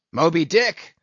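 loud, startled captain's shout. A narrator's reading. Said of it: "shouted Ahab".

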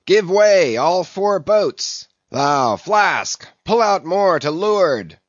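high, loud shouted orders; cried command. A narrator's reading. Captain commands all four boats to row, crying out. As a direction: holler orders from boat to boat. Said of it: "cried Ahab".

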